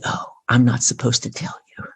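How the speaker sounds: hushed mock-secret whisper; playful breath-only confiding; conspiratorial whisper. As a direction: whisper it like classified information.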